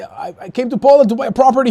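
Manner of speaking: high, loud mock-innocent buyer; high naive client voice. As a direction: play the starry-eyed investor, loud and high. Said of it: "When they say".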